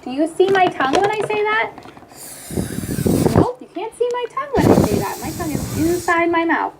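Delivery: put-on high, bright coaxing voice for a little kid; talking to a child. Therapist role-playing a session with a child, encouraging. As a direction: coax the child to watch your mouth.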